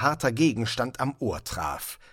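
ordinary delivery

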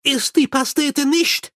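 high, urgent call. A narrator's reading. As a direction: call a warning after someone in alarm. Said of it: "rief er".

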